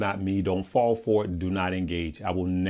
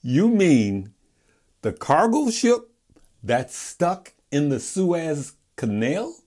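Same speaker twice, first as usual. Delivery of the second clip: incredulous; 5 semitones higher than usual. Retold or imitated. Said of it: "I said".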